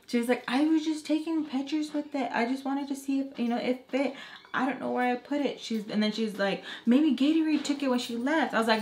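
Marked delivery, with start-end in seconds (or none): 0.5-5.6 put-on high whiny teen excuses. Teen girl making excuses to her mom. 6.8-8.5 put-on high, louder whiny teen; whiny blame. Teen girl blaming the other makeup artist.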